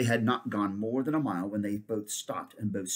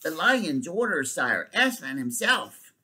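shouted; 4 semitones higher, 3 dB louder, slower than usual. Acted.

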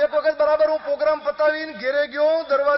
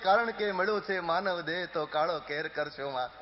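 soft closing repeat; 6 semitones lower, 7 dB quieter than usual. Acted. Closing moral line, repeated soft.

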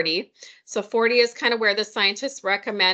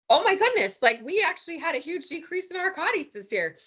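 much higher, gushing "Oh my goodness"; thrilled staff quote; 9 semitones higher than usual. Retold or imitated. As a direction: imitate delighted nurses squealing over good news.

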